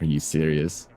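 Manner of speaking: ordinary delivery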